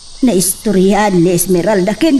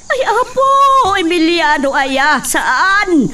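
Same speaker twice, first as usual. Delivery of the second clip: much higher, frightened plea; alarmed pleading; 10 semitones higher than usual. Acted. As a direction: cry out in fear, begging him to stop. Wife alarmed, pleading with husband.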